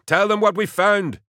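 very high, loud urgent yell. A narrator's reading. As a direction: shout it desperately. Yelled to Raglan.

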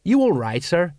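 understated check-in; repeated question. A narrator's reading. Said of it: "Colon repeated".

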